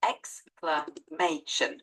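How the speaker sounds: "exclamation" slowly as ex, cla, may, shun; broken into syllables. Shown as a demonstration. Tutor breaking it down for a learner, "exclamation" slow, syllable by syllable. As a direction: separate each syllable of "exclamation". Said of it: "Can you break it down".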